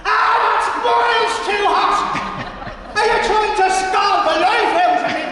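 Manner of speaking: Pat scalded by the hot water; mighty roar. Acted. Scalded old man roaring. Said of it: "letting out a mighty roar".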